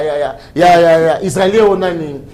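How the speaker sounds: ordinary delivery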